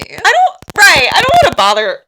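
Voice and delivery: high, loud mock whine; whiny complaining. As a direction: exaggerate your own whiny complaint. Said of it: "so whiny and complaining".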